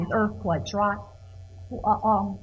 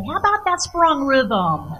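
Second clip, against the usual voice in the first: loud, higher, hammed-up party remark; mock enthusiastic exclamation; 5 semitones higher, 8 dB louder than usual. Teacher joking about cocktail talk with friends, mock excited outburst. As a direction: burst out with it as a playful boast. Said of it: "just at some moment just say".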